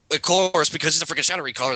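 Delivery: ordinary talk, nothing marked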